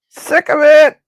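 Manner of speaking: sudden high, loud yell of frustration; frustrated shout. Real, not acted. Cook losing patience with the dish, yelling. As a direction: blurt it out, fed up.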